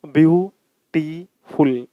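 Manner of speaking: ब्यूटीफुल said very slowly, each syllable opened wide; teacher drawing out ब्यूटीफुल to show its syllables; slow syllable demo. Shown as a demonstration. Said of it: "आप ब्यूटीफुल बोल के देखो".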